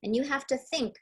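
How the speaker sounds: ordinary talk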